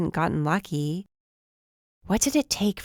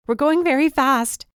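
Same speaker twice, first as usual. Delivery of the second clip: tearful; 9 semitones higher, 5 dB louder than usual. Acted. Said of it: "There were tears in my voice".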